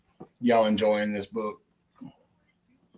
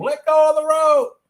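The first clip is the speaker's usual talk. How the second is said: high, loud scream; 9 dB louder than usual. Acted. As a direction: scream it in terror. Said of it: "the old man looked at Harry and screamed".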